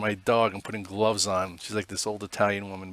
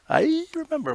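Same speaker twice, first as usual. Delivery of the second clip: "I remember." jumped far higher in a sing-song lilt. Shown as a demonstration. Echoing the tweet he wants from viewers, sung high. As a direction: chirp "I remember." up high like a cute echo.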